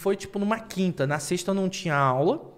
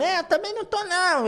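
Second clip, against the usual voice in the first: classmate; 13 semitones higher than usual. Retold or imitated. Voices classmate agreeing.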